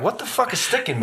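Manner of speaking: high, startled what the fuck; mock startled yelp. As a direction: jump up surprised and annoyed. Sat on something sharp.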